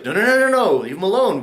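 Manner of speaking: high, loud string of no's; urgent mock protest. Voicing Daniel's idea.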